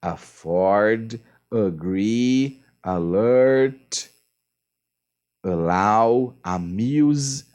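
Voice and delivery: teacher listing verbs for students to practise; practice word list. Shown as a demonstration. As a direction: read the practice verbs one at a time. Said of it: "faça com advise".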